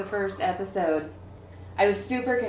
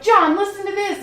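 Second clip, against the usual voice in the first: excited; 10 semitones higher, 7 dB louder than usual. Retold or imitated. Calling her husband excitedly.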